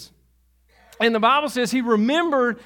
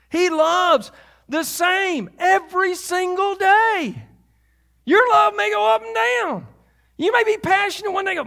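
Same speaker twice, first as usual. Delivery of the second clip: fervent preaching; 8 semitones higher than usual. Acted. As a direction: preach it with growing conviction. Preaching at full force.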